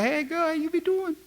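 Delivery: high-pitched Cajun greeting, hammed up; South Louisiana accent. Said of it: "they be talking like this here".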